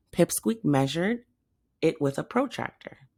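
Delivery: usual speaking voice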